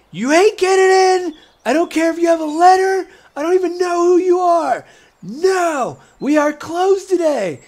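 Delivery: very high, loud gnome yelling; angry gnome inventor. Pretend. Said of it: "you see her like".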